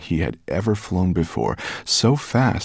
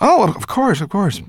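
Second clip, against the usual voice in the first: flustered agreement; 13 semitones higher, 5 dB louder than usual. A narrator's reading. Boy hurries to help the rat.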